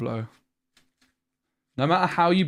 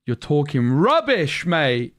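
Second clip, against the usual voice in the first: loud, indignant outburst, slowed and higher; triggered rant; 3 semitones higher, 5 dB louder, slower than usual. Real, not acted. Streamer raging at a viewer's comment, loud and indignant. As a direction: snap back at the chat, really riled up. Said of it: "I got triggered".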